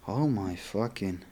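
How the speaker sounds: ordinary delivery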